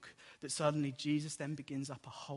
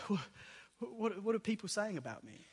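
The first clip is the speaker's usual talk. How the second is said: lightly acted higher-pitched question; piping up; 6 semitones higher than usual. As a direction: pipe up with a casual question, a touch higher. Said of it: "Jesus pipes up".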